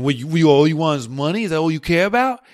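loud, hammed-up New York accent. Guest mimics skeptics accusing him of greed, loud New York accent. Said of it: "that's my New York accent".

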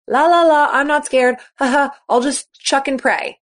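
high, careless la-la-la; mock carefree denial. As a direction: brush off the fear like a careless kid. Mocking athletes ignoring fear.

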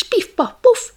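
playful shooting noises; sound effect. A narrator's reading. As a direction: fire them off like shots.

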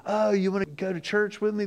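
a hint of a sing-song fake friend; mock cheery invite. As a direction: play the gossip sweetly asking a friend to church.

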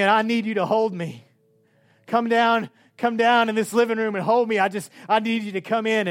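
re-enacted loud plea to Jesus; crying out. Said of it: "crying out to Jesus".

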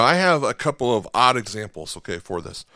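normal talking voice

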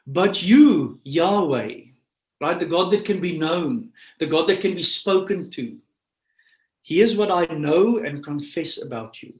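emphatic preaching. Acted. Preacher reads David's cry to God, emphatic preaching. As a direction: proclaim it to the room, voice lifted.

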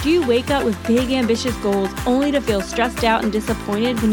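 upbeat scripted intro voiceover; bright host read over music; podcast intro read. Acted.

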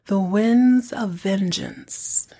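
slow, breathy whisper of the title; dramatic whisper. Acted. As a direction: whisper it like a movie trailer. Dramatic reading of the episode title.

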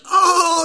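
loud high shocked "Oh"; shocked cry. Retold or imitated. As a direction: yelp as the crash lands. Brother crashes into toy box.

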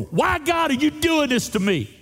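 high, pleading cry to God; a pained, high complaint to God; anguished why. Retold or imitated. Pastor voicing a pained prayer, high and pleading.

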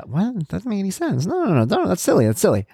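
high, hushed incredulous protest; acted-out baffled denial; mock disbelief. Acts out dismissing a jealousy remark.

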